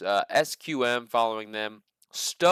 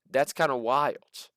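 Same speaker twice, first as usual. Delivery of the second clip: ordinary talk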